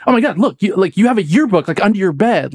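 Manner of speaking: high, incredulous retelling of a roommate; mock-amazed discovery, high-pitched; astonished realization. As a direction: act out the penny dropping, pitched well up. Roommate piecing the prank together, retold.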